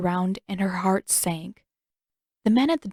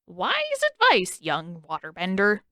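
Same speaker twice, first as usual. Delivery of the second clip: put-on sly old king. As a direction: drag out the praise like a smug tyrant. King mocking, cackling villain.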